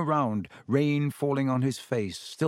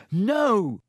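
a higher, alarmed no; sharp no; 8 semitones higher, 5 dB louder than usual. A narrator's reading. Harry refusing Lockhart's help, a sharp alarmed no. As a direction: cut him off fast and high. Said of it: "said Harry".

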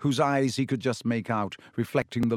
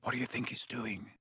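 much softer hushed question; 12 dB quieter than usual. A narrator's reading. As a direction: keep it to a frightened hush. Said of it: "said Harry".

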